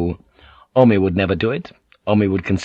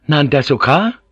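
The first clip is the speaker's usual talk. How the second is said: higher question with a touch of irritation; mildly irritable; 5 semitones higher, 4 dB louder than usual. A narrator's reading. Omi towers over Blackthorn and asks, mildly irritable. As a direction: ask it pitched up, mildly impatient. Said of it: "Omi repeated more irritably".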